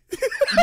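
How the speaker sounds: loud, high squeal of a laugh; high burst of laughter. Real, not acted. Browns challenge is a joke.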